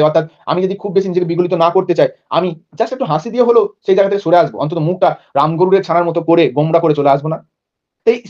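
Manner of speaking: ordinary talk, nothing marked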